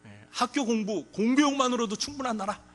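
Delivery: loud, high, excited campaign promise; eager promise. Pretend. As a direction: win the kids over with a big pledge. Candidate courting children. (아이들 표를 얻으려는 후보의 신난 약속)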